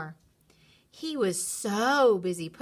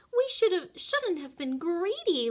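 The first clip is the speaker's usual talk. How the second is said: sighing; 10 semitones higher, 3 dB louder than usual. A narrator's reading. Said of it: "Lottie sighed".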